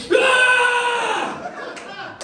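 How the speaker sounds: high yelped party scream. Acted. Mocking a partygoer with a high shriek. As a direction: let out a sudden high party yell.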